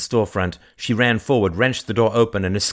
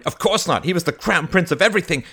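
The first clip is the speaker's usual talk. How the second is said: high, loud sarcasm; resentful outburst; bitter cry; 11 semitones higher, 6 dB louder than usual. Acted. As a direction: answer your own question scornfully, speeding up. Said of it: "cried Mary".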